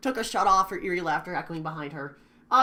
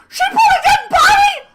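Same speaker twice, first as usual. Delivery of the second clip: very high, loud shocked scream; gasping shriek of terror; horrified shriek; 17 dB louder than usual. Acted. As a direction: scream in horror. Finding a body, gasping.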